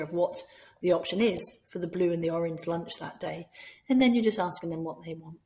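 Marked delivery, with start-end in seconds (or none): none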